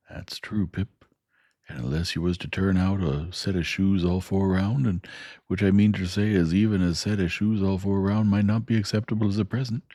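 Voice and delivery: kindly, ponderous working man reasoning it out; Joe the blacksmith. Acted. Joe voiced in story.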